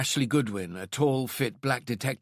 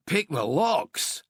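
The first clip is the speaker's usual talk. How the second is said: slow, higher, incredulous echo; disbelieving; 6 semitones higher, 3 dB louder, much slower than usual. Acted. Donnelly scoffing at the boss's lockpicking idea, slow incredulous echo. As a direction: repeat it slowly as if it can't be true. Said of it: "asked disbelievingly".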